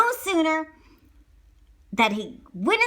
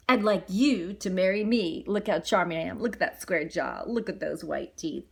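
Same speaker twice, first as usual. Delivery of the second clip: low, smug, softer bragging; boastful Gaston; 6 semitones lower, 3 dB quieter than usual. Acted. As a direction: show off the jaw and teeth.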